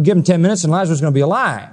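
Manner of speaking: ordinary delivery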